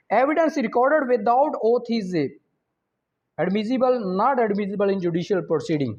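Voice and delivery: slow, careful reading of an exam question; measured quiz reading. Acted. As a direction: read each option out deliberately. Reads exam question slowly.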